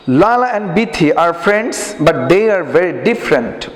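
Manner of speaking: slow, careful English read-aloud for pupils. Shown as a demonstration. Teacher reading slowly to the class. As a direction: read each word slowly so students copy. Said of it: "উচ্চারণগুলো খেয়াল করবা".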